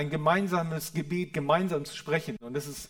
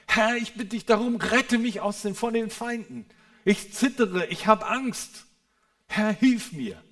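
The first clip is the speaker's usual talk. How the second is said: higher and louder, a pleading frightened cry to God; desperate crying out; 7 semitones higher, 7 dB louder than usual. Retold or imitated. Preacher voicing David crying out in fear to God, higher and louder with pleading. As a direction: beg for rescue as if enemies close in. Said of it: "Wie hat David zu Gott geschrien?".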